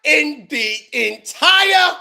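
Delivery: slow, drawn-out shocked exclamation; stunned outburst. Real, not acted. Host hearing the guest blame YouTube, stunned and slow. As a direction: drag it out in total disbelief.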